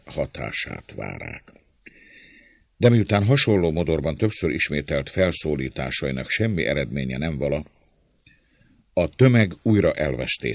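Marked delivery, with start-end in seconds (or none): none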